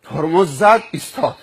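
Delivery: ordinary talk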